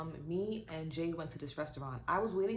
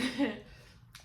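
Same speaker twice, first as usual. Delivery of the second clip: short real laugh; 5 semitones higher than usual. Real, not acted. Laughing about rushing.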